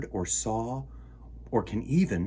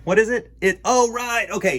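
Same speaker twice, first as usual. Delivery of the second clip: high, loud burst of remembering; sudden realization; 9 semitones higher, 7 dB louder than usual. Acted. Host suddenly remembering his show's name, high and loud. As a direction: snap up high as it comes back.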